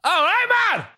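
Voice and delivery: high, very loud Geordie bellow; shouting Geordie bloke. Retold or imitated. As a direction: imitate a tipsy Geordie shouting across a queue. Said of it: "there was a Geordie bloke in the queue".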